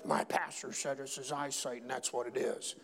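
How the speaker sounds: low, soft know-it-all answer; undertoned imitation of someone repeating the pastor; mock parroting congregant. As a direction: drop low and quiet, mimicking a church member. Mimics congregant parroting his answer.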